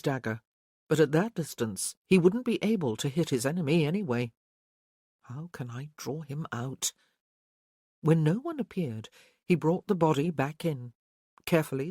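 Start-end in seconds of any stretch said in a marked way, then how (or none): 5.2-6.9 murmured to himself; quiet thought. A narrator's reading.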